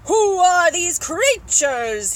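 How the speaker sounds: very loud, drawn-out outraged question; bellowing. Acted. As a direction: bellow it slowly, word by word. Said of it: "he bellowed".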